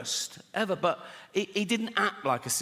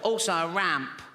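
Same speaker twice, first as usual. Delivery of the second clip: put-on kid voice. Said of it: "do the voice".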